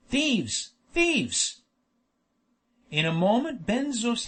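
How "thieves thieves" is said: high-pitched yell at contained volume. A narrator's reading. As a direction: cry out in alarm, second cry higher. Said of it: "began to yell with all his might".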